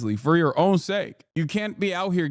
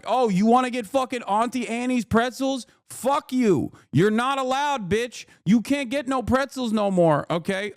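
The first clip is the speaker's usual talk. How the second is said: mocking cop, pitched up and rude; 7 semitones higher than usual. Cops banning Roy Moore from the mall, mocking high voice. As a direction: sneer at him like a bored mall cop.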